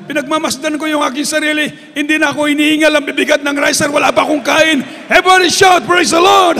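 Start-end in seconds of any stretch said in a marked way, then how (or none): 1.9-6.5 loud, jubilant preacher's cry; shouted testimony. Acted. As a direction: rouse the congregation to shout praise.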